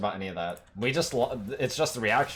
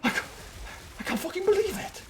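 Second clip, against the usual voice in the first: much higher, breathless mock disbelief; imitating out-of-breath Karn. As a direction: ham up Karn losing his mind, gasping. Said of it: "He's, like, out of breath somehow".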